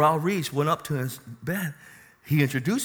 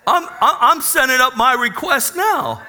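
laughing; 9 semitones higher, 7 dB louder than usual. Real, not acted. Preacher laughs at own wish.

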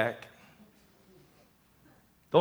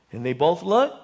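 ordinary delivery

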